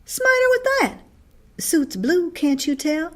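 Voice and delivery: put-on high, loud boyish retort; a brother snapping back about the tie. A narrator's reading. Boy retorts in story.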